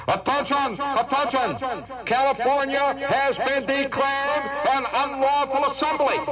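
slow, drawn-out official loudspeaker announcement; police helicopters ordering California to disperse. Retold or imitated. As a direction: announce it slowly from the sky. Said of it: "they're saying".

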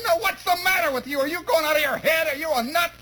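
high-pitched exasperated mom voice; scolding mother. Acted. Mother tells off son lying on the floor, exasperated scolding. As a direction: scold like a mother at her wits' end.

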